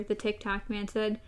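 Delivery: ordinary delivery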